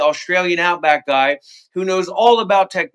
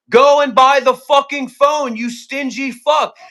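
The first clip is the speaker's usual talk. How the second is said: angry shout; 7 semitones higher, 4 dB louder than usual. Real, not acted. Berating a stingy man.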